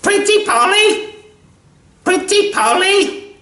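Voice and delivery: very high, loud squawking parrot. Retold or imitated. Mocking people who just repeat lines, squawking parrot voice. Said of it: "Little more than a parrot".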